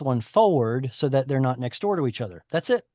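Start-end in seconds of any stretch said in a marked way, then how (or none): none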